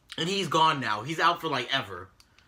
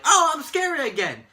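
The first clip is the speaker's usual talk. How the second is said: much higher, louder mocking squeal; mock Bray Wyatt; 12 semitones higher, 7 dB louder than usual. Mocking Bray Wyatt after a rare win, high sarcastic squeal. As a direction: send up a loser suddenly feeling scary. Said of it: "to, like".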